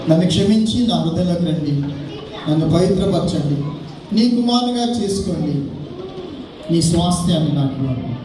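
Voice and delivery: usual speaking voice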